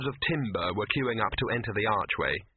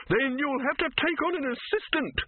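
high-pitched, angry snarl. A narrator's reading. Silverfish retorts to the troll throwing him out, snarling. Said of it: "snarled Silverfish".

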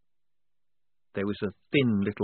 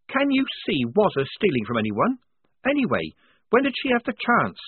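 put-on higher, louder, indignant young woman; Polly's voice; 10 semitones higher, 3 dB louder than usual. A narrator's reading. Polly accusing Strappy in front of the squad, indignant and higher. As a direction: press the accusation, a little heated.

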